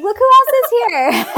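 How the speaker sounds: put-on very high, loud sing-out to a toddler; excited reveal. Parents presenting the new baby to big brother, excited. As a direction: imitate parents unveiling a big surprise.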